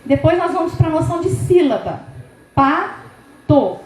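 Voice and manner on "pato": "Pato" broken into two beats, pa, to; split into syllables. Shown as a demonstration. Teacher shows trainees the syllables of pato, split apart. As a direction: say "Pato" syllable by syllable with a pause between. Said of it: "Tem duas sílabas".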